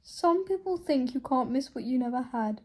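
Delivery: usual speaking voice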